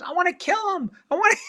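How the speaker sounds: high put-on woman's voice, mock threat; mock upset wife. Scared wife threatening the director, in a high mock voice. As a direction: play the rattled wife, half-joking.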